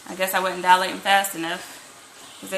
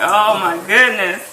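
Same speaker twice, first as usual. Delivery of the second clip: loud, drawn-out incredulous reaction; hammed-up exasperated amazement; mock disbelief; 9 dB louder, slower than usual. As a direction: stretch it out in retold disbelief. Retells her reaction to silly remark.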